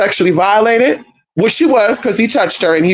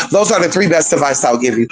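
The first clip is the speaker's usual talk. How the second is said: usual speaking voice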